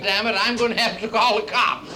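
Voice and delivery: high, loud comic wail of frustration; mock tearful outburst. Failed old lover gives up in tears, mock wailing. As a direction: blurt it out high, hammed-up near tears. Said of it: "he finally burst into tears and said".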